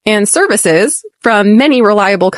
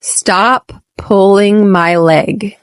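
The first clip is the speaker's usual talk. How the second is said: slow, each word of "Stop pulling my leg" drawn out clearly; slow model take; 2 semitones lower, slower than usual. Shown as a demonstration. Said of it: "Repeat after me".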